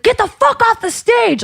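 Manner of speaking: very high, slow mock yell; retold aggressive shout, words stretched out; imitating aggressive heckler. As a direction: holler it as an angry heckler would. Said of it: "Like, really aggressive".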